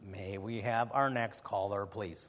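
low, slow, soft radio host sign-off; imitating Camping. Mimicking the radio host moving to the next caller, slow and low. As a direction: mimic the old host's dry call for the next caller. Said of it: "the way he, he said".

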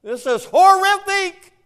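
high, loud, slow outcry from the pulpit; a slow, loud preacher's wail; preaching cry. Real, not acted. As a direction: cry it out in horror. Preacher's outraged opening cry.

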